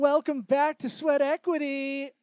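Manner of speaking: booming radio announcer intro. Pretend. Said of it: "I didn't do the radio voice".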